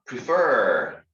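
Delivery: PREFER with a loud, drawn-out FER; stress on second syllable. Shown as a demonstration. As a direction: lean hard on the end of prefer. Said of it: "intonation is in the second syllable".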